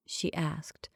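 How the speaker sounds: usual speaking voice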